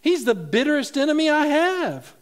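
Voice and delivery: high, loud exclamation; old preacher exclaiming loudly; astonished. Retold or imitated. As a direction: blurt it out in shock. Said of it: "exclaimed the old preacher".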